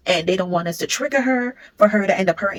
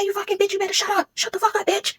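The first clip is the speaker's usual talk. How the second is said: very high mock screech at a crying baby; imitated high-pitched screaming rage; imitating furious screaming; 13 semitones higher than usual. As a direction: shriek it up high like the mother losing it.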